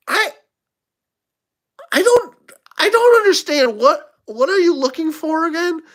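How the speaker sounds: high bewildered waitress voice; baffled waitress. Retold or imitated. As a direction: squeak out her confusion.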